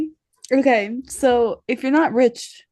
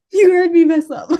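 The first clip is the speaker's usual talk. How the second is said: laughing hard through the words, louder; 6 semitones higher, 7 dB louder than usual. Real, not acted. Teacher confessing to the podcast cohost, laughing hard. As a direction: crack up as you say it.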